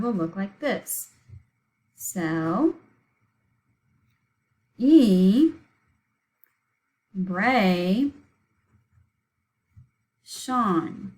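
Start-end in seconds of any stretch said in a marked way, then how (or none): 1.9-11.1 "Cel-e-bra-shon" with long pauses between drawn-out syllables; slow syllable breakdown. Shown as a demonstration. Teacher writing the word out for pupils, extremely slow syllables. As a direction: stretch each syllable of "Cel-e-bra-shon" and wait before the next. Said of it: "we said it slowly and broke it up".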